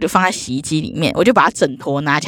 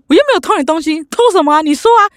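indignant denial; 9 semitones higher, 3 dB louder, faster than usual. Retold or imitated. Angry neighbour denies theft.

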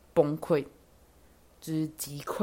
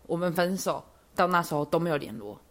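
normal talking voice

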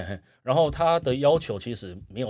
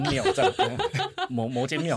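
trying not to laugh; 2 semitones higher than usual. Real, not acted. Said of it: "憋笑憋到快不行".

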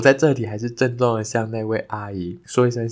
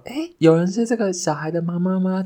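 kind aunt calling out; 9 semitones higher than usual. Retold or imitated.

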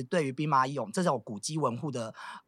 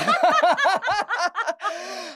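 high squealing laugh; 5 dB louder than usual. Real, not acted. Laughing at own joke.

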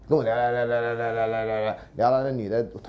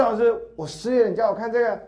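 incredulous student; 11 semitones higher, 4 dB louder than usual. Retold or imitated. Student's shocked protest retold.

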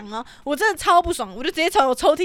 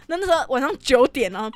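usual speaking voice

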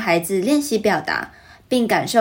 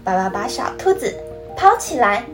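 put-on reading to a toddler; 2 semitones higher than usual. Storytelling narration with character voices.

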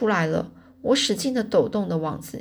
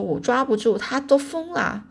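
high, helpless protest; shouting; 5 semitones higher than usual. A narrator's reading. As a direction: cry out in exasperation, stretching the end. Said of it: "我就喊着说".

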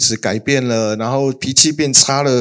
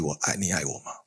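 much softer, lower mocking question; mock hurt plea; 8 semitones lower, 14 dB quieter, faster than usual. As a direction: drop to a tiny wounded echo. (低声轻轻地模仿发问)

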